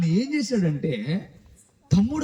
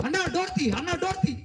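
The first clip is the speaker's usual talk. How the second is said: crying; 9 semitones higher than usual. Acted. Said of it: "ఏడుస్తూ".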